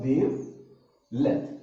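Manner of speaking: Wavelength stretched out, every syllable drawn out slowly; slow, careful wavelength for the class. Shown as a demonstration. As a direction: say wavelength very slowly so students catch it. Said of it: "मैं आराम से बोलता हूँ".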